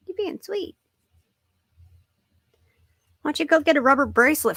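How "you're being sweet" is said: high, soft cooing; soft high pet talk. Real, not acted. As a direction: coo to the cat. Cooing to the cat.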